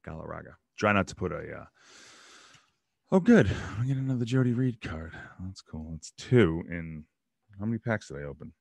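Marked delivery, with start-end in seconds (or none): none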